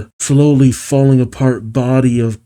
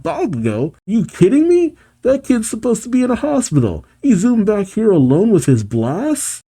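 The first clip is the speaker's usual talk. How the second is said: high-pitched incredulous calling out; Present Mike; 9 semitones higher than usual. A narrator's reading. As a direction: call it out in high, disbelieving astonishment. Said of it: "As Present Mike calls out".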